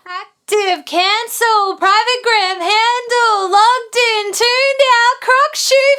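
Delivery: building to a shout. Acted. Building spoken lyric take.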